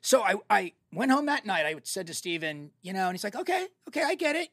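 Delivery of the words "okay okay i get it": higher-pitched imitation of someone conceding; placating agreement. Spielberg responds to the director's explanation, placating. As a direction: raise the pitch, lightly placating.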